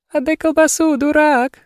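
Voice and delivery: very high squeaky mock-girly voice. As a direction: squeak it like a silly girl. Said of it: "Писклявым голосом закончила".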